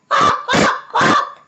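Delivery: very high, loud squawking quacks; raven's call. A narrator's reading. Raven calling to tease the hungry cub. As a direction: squawk it like a bird. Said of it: "came a raven's call".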